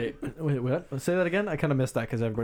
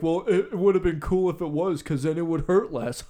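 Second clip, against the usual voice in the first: mocking coworker; 5 semitones higher, 3 dB louder than usual. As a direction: make him sound dim. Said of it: "And he's like".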